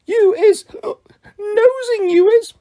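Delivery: put-on jab at him, drunk and cross; high accusing house-elf voice; accusing elf squeak. A narrator's reading. Drunk Winky accuses Harry.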